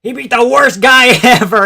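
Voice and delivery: yelled through a laugh; laughing shout. Real, not acted. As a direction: shout it, cracking up. Repeat with laugh.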